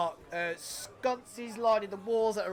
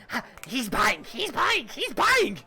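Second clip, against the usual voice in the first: put-on rising, higher cackle of a little old man; excited old man; 4 semitones higher than usual. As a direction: squeal it higher each time. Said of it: "the little old decrepit man now running to the bar going".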